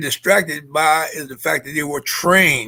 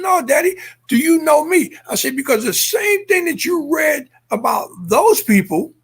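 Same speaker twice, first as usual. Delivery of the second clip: emotional plea; 9 semitones higher than usual. Retold or imitated. Son pleads tearfully with his father over the newspaper lies.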